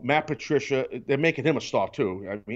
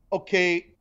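ordinary talk

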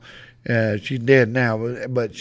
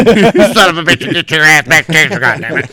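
angry wife; 3 semitones higher, 7 dB louder than usual. Retold or imitated. Wife ordering husband back inside, loud mock fury. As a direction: imitate a furious wife ordering him back. Acting out wife scolding him.

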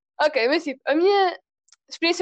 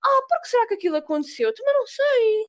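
mock innocence; 7 semitones higher than usual. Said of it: "vai ficar assim".

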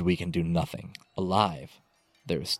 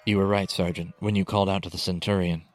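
ordinary delivery, nothing marked

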